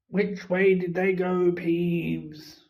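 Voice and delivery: lightly voiced grumpy caretaker; gruff caretaker. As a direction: demand it of the ghost. Filch voiced.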